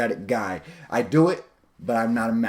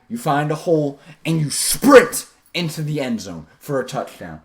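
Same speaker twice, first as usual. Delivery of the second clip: ordinary delivery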